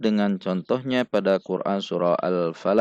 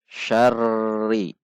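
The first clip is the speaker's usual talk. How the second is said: "Syarri" dengan r bergetar rata tanpa putus; tekanan sama. Shown as a demonstration. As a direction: tahan getar r rata pada "Syarri". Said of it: "Itu berarti tekanannya sama". (contoh salah: "Syarri" bertekanan tetap)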